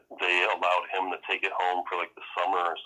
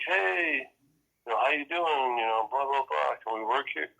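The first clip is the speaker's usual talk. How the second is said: mock hippie greeting; 6 semitones higher than usual. Mock strangers greeting at door.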